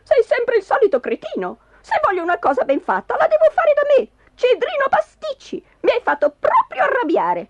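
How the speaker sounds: high-pitched angry scolding; shrill-pitched tirade ending in a curse; furious wife. Acted. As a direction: rail at your husband, voice soaring. Enraged wife insults and curses husband.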